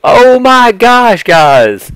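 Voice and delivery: high, loud, drawn-out amazement; amazed exclamation. Acted. Vlogger showing viewers the controller, amazed cry. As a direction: gush loudly at the reveal, stretching it out.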